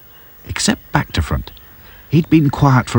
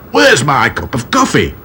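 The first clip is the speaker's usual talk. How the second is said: angry; 6 semitones higher than usual. A narrator's reading. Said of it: "an angry Mister Grimes".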